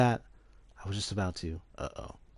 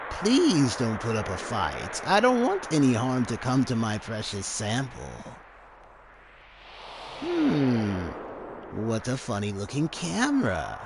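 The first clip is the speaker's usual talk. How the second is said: creepy mad scientist; 3 semitones higher than usual. Acted. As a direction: savour every word like a greedy villain. Voicing Hojo.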